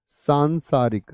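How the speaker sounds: "सांसारिक" drawn out, long सां clearly held; slow model word. Shown as a demonstration. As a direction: spell out "सांसारिक" slowly with a long first vowel. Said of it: "कैसा होगा?". ("सांसारिक" धीरे, लंबे सां के साथ)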